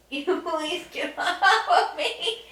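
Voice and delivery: high, slow, laughing plea. Real, not acted. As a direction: beg while laughing. Laughing under the dog.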